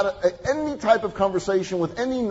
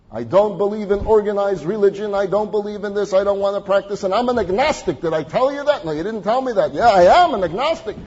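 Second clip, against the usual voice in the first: insistent refusal; 4 semitones higher, 6 dB louder than usual. Retold or imitated. Man insists to the rabbi.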